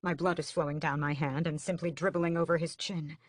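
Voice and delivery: ordinary delivery, nothing marked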